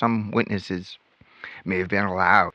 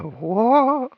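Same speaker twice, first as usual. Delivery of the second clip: mock shock. Mocking reaction to a cuss word.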